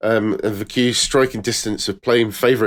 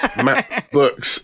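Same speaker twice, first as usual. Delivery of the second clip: laughing; 14 semitones higher, much slower than usual. Real, not acted.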